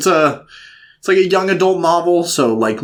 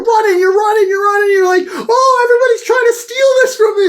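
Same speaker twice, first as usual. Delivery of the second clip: frantic yelling. Pretend. Narrating the kid racing home, frantic shouting. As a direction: yell it like a chase.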